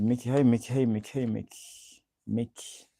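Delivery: normal talking voice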